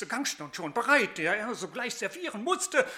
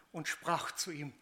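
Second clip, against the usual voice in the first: ordinary delivery, nothing marked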